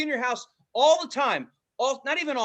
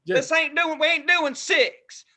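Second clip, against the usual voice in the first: mock Southern; 6 semitones higher, 4 dB louder than usual.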